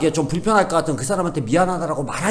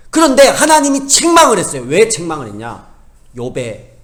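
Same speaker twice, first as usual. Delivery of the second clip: ordinary talk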